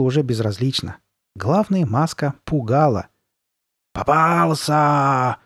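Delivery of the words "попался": very long drawn-out, menacing howl; drawn-out gloating wail; monster howl. A narrator's reading. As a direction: howl the word out slowly to terrify. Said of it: "завыла она".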